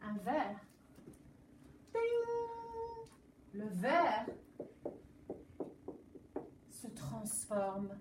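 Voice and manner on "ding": high sung ding sound effect; a bright little ding; magic ding. Acted. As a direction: chime it like a magic bell. Magic sound as glass turns gold.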